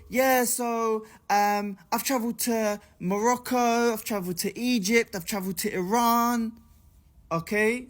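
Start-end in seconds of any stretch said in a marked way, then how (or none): 0.1-6.6 higher, put-on voice listing places; mock woman's voice. Storyteller imitating woman boasting of her travels, higher mock voice. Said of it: "the woman goes".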